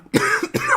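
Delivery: sharp high cough mid-word; real cough breaking the sentence; sudden coughing interruption; coughing fit. Real, not acted. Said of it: "I'm so out of breath".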